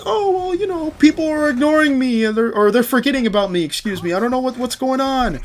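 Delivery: high falsetto mock confusion; mock confused whine. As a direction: play the baffled, ignored hero in falsetto. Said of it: "So you're like".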